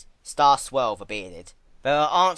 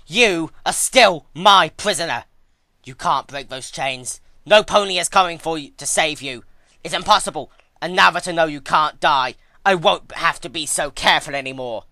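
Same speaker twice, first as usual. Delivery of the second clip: stomp and roar it at your prisoner; furious threat; 4 semitones higher, 10 dB louder than usual. Acted. Twilight stomps a hoof, threatens prisoner.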